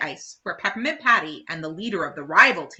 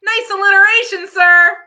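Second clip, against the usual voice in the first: much higher, very loud bright compliment; Marcy declaring; 13 semitones higher, 13 dB louder than usual. Acted. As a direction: proudly announce it. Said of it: "Marcy declares".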